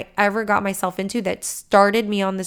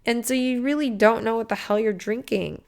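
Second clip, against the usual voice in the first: ordinary delivery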